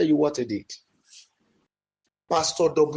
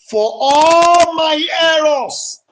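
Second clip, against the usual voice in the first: shouted plea; 11 semitones higher, 9 dB louder, much slower than usual. Acted. Climax of the prayer.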